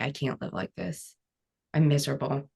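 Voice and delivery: soft, subdued retelling of despair; retold despair. Survivor retelling her breakdown at home, quietly despairing. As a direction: recount the breakdown quietly, without crying. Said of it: "crumbled to my floor and started crying".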